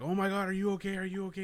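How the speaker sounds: high-pitched worried fussing, retold; mock alarm, pitched well up; alarmed technician. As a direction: imitate a startled bystander checking on someone. Retold technician reacting to his fall.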